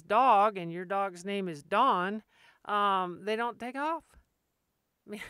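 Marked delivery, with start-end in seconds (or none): 0.0-0.6 judge calling out. Retold or imitated. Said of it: "and the judge says".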